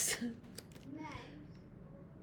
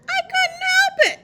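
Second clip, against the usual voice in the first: put-on high, loud little-boy whine of an excuse; child's excuse; 8 dB louder than usual. A narrator's reading. Reader voices David making excuses to children, loud high kid voice. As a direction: protest loudly in a high kid voice.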